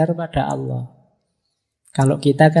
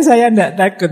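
mock yell; 5 semitones higher, 6 dB louder than usual.

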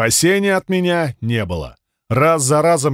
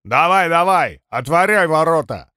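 put-on higher, slurring drunk urging; slurred drunk shout; 3 semitones higher than usual. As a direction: hurry them like a drunk. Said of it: "изображая пьяного".

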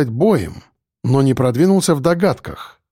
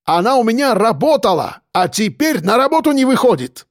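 high-pitched, agitated complainant; excitable character voice, indignant; indignant Caucasian man; 8 semitones higher than usual. A narrator's reading. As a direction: press the complaint in a high, heated voice.

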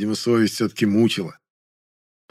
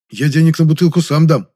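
higher, louder eager-to-atone offer; hasty, guilty offer; 7 semitones higher, 7 dB louder than usual. A narrator's reading. Guilty Жасминов offers bottle money, hastily. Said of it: "торопливо заявил виноватый Жасминов".

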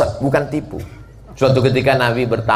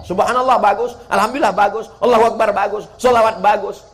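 eager listing; 9 semitones higher than usual. Acted. Listing with rising enthusiasm.

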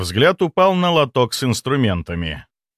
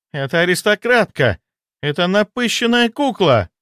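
high, indignant contempt; jealous scorn; 9 semitones higher, 4 dB louder than usual. A narrator's reading. Jealous mistress insulting her rival, high and scornful. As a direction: sneer at the rival in a raised pitch.

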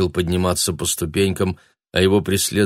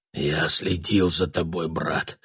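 hoarse whisper; 2 semitones lower, 5 dB quieter, slower than usual. A narrator's reading. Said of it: "послышался хриплый шёпот".

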